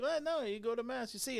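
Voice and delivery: high, mock-patient explaining; lightly hammed-up explainer; mock comedian. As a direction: play the comedian spelling it out. Comedians voiced in retold story.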